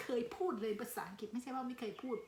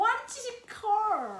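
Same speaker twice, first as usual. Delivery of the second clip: fumbled English; 11 semitones higher, 10 dB louder, much slower than usual. Real, not acted. Fumbling English food name.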